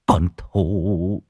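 low, drawn-out, choked name; tearful. Acted. Husband grieving over his daughter's name, tearful. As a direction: trail the name out in grief. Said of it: "nước mắt lưng tròng".

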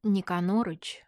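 ordinary delivery, nothing marked

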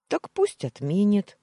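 high, loud, playful retort; loud cheerful suggestion; smiling. A narrator's reading. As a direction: toss it off with a grin. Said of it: "улыбнулась я".

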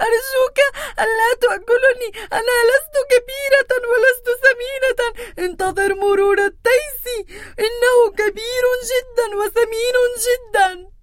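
high, tearful pleading; trembling voice. Acted. Second goat begging the troll, high and tearful. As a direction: beg through tears like a scared goat. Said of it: "بصوت مرتجف".

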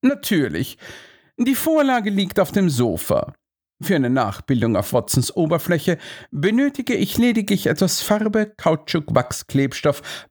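high, confident detective voice; lofty sleuth, pitched well up; self-assured Holmes. A narrator's reading. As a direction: explain the plan with smug assurance. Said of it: "Holmes lächelte selbstbewusst".

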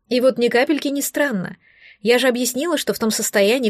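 plain reading voice

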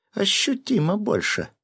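very high, womanly complaint; indignant. A narrator's reading. Former bearded man comparing chests, indignant female voice. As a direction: insist with pitch way up. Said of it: "возмутился бывший бородач".

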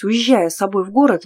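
plain reading voice

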